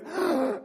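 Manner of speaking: a sudden high gasp of wonder; mock astonished gasp; sharp gasp. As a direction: gasp as if struck by a verse. Mock discovery while reading Isaiah.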